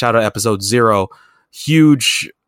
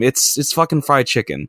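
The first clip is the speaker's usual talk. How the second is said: usual speaking voice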